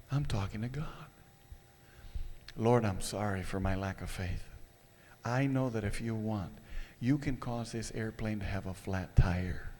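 hushed, repentant murmur to God; quiet inner prayer. Retold or imitated. Inner prayer, catching himself.

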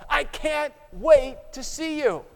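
joyful eager welcome; enthusiastic Savior. Acted. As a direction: gush with happy anticipation. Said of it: "the Savior's voice grows more enthusiastic".

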